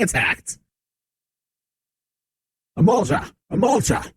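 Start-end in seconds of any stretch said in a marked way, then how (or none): 2.8-4.1 alarmed guard cry. Acted. Guards spot a monster attacking.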